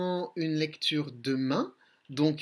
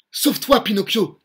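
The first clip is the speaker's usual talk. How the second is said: louder, higher warning call; father shouting for his son to escape; urgent cry; 5 semitones higher, 9 dB louder than usual. Acted. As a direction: yell for him to run. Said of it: "crie Geppetto".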